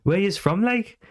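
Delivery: light Geordie impression; Geordie accent. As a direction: play a friendly Newcastle local, pitch up. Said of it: "he went".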